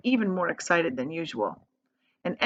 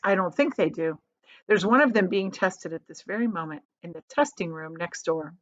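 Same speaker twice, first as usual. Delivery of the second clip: plain reading voice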